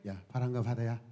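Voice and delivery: quiet rebuke, like to a pet; hushed tender scolding of the wind; soft scolding. Acted. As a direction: gently tell the storm to settle down. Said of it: "집에서 길러온 짐승에게 해야지".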